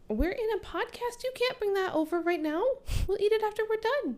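high, quick scolding of partner; playful scolding. Real, not acted. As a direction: tell them off lightly, brisk. Scolding partner bringing food over.

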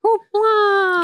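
very high squeal of Hoopla; excited exclaim. Real, not acted. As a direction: shriek Hoopla in delight.

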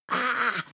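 short high scream of fright; high acted shriek; startled scream. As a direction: scream as if jumped from behind. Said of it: "he screamed and fell backwards".

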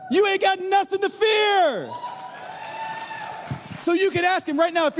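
high, impassioned preacher's cry; full-force preaching. Real, not acted. Preacher telling the church God is behind them, impassioned. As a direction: build to long, high shouted declarations.

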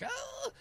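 sudden very high exhausted yelp; high collapse cry. Retold or imitated. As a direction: let out a tiny high cry as the legs give way. Collapsing at race finish.

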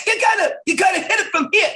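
high excited comedian yell; Chris Rock coaching. Retold or imitated. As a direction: pitch it way up from the top. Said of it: "He goes, he goes".

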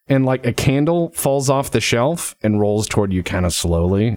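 ordinary delivery

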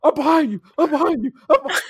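high retold shouting, climbing each time; retold yelling. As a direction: imitate yelling after a car. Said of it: "I am screaming as loud as I can".

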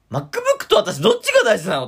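shrill jealous lover, half joking; jealous girlfriend. Pretend. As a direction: play the jealous partner demanding an answer. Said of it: "大ちゃん、こんな男になっちまった". (嫉妬する恋人風に)

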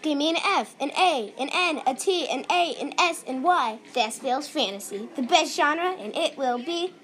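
loud cheerleader chant. Pretend. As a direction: shout it like a pep rally. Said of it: "a cheerleader cheer".

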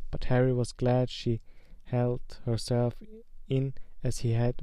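plain reading voice

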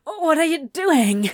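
breathless gasps rising high and loud; gasping. A narrator's reading. Anthony overwhelmed by Crowley, breathless gasping. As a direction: gasp it out, overwhelmed. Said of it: "Anthony gasped".

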